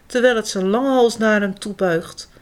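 ordinary delivery

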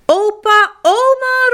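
high, loud happy call; joyful call. Acted. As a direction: shout with delight at the door. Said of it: "roept hij blij".

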